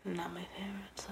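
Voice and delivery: very soft, voiceless aside; confiding whisper. Real, not acted. Admitting to the co-host a dislike of his paintings, confiding whisper. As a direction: whisper the criticism as if confessing.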